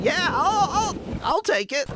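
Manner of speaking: put-on high, cracking old miner voice; prospector voice. Shown as a demonstration. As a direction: whine it like a grizzled prospector. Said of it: "a voice like this".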